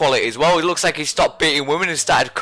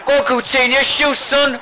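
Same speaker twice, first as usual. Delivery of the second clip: urging on; 7 semitones higher than usual. Real, not acted. Urging player to shoot.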